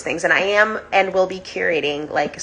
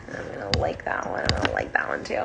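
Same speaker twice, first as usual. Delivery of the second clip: whisper; 8 dB quieter than usual. Real, not acted. Liking viewers' comments on live.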